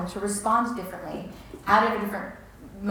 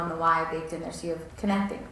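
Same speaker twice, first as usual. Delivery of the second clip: ordinary delivery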